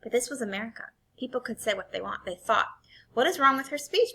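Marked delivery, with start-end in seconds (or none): 3.1-4.1 puzzled rising question; a hint of a puzzled mother. A narrator's reading. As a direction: ask it in genuine surprise. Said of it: "my mother questioned him".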